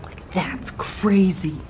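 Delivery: slow, hushed whisper; awed whisper. Real, not acted. Vlogger staring at the hotel view, whispering in awe. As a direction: breathe it out in awe.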